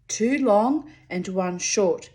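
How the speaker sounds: ordinary delivery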